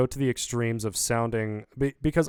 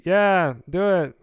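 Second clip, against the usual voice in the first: offhand half-shout, a bit higher and louder; lazy shout; 6 semitones higher, 6 dB louder, slower than usual. Shown as a demonstration. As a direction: toss it out without effort.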